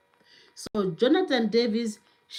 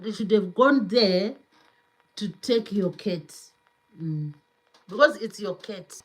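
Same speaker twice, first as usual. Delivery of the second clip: usual speaking voice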